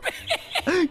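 high-pitched giggle; quick high snicker; laugh. Real, not acted. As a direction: giggle at the teammate's confusion. Laughs at teammate's confusion.

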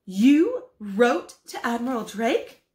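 incredulous. Acted. Wife asks husband, amazed. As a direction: ask in disbelief.

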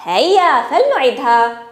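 ordinary talk

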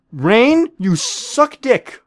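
loud, high jeer, the last words dragged slow; taunting yell. Real, not acted. Gamer yells at a player named Rain who just escaped, a loud mocking jeer. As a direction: yell the insult, then stretch it out.

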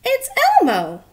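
high cheerful Elmo. Acted.

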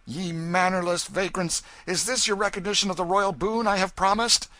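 high, loud royal rebuke; sharp indignation. A narrator's reading. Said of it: "He said sharply".

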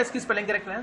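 ordinary delivery

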